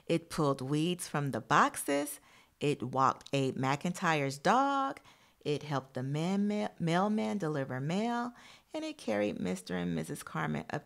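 put-on storybook voice for young listeners; reading to children. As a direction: read the picture book out to the class. Reads picture book to class.

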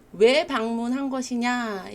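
ordinary delivery

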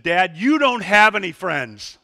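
loud, slow, laughing mock anger; angry child. Daughter telling dad off, loud mock anger. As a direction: deliver the put-down as a furious kid. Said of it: "as angry as a little eight-year-old could be".

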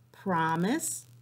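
ordinary delivery

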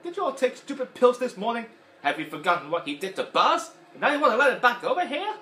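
high, loud scornful rant; Mister Potato Head voice. Retold or imitated. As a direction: scold the other toys. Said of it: "And he's like".

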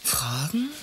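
a breathless, startled whisper of the word. Acted. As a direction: whisper it back in alarm, questioning. Secretary startled by police questions.

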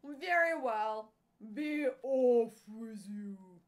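loud, slowing troll voice; troll dismissal. A narrator's reading. Troll letting the middle goat go, loud grudging dismissal. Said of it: "said the troll".

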